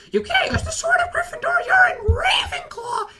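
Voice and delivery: very high, shouting protest; indignant kid. Retold or imitated. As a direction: shriek the rules at him like a kid. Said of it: "he just goes".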